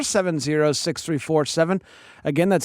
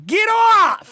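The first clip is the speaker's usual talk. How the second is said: much higher, louder shout imitating his dad; dad yelling get off; 5 dB louder than usual. Host quoting his dad shouting at him as a kid, loud shout. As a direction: bark it like a fed-up father shooing his kid. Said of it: "my favorite quote from my dad".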